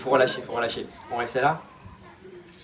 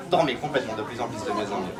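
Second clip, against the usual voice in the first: much lower and softer trance voice; hypnotic deepening; 11 semitones lower, 8 dB quieter than usual. Acted. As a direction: let the voice sink into sleep.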